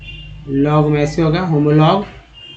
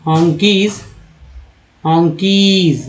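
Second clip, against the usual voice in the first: slow repeat; slower than usual. Shown as a demonstration. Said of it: "दो तरह के ईज लिखा जाता है".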